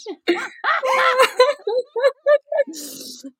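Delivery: long, high squealing laughter; helpless, breathless giggling; high laughing fit. Real, not acted. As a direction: lose it in a high cackling fit.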